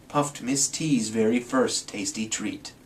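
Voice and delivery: ordinary delivery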